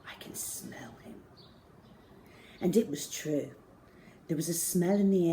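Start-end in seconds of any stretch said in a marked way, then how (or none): 0.0-1.2 breathy unvoiced whisper; sniffing out danger in a whisper. A narrator's reading. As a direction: whisper so nobody hears. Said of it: "he whispered".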